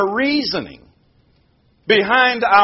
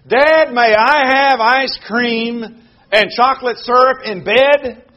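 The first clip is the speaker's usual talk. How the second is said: put-on pleading child; 6 semitones higher, 6 dB louder than usual. Child begging parent in sermon.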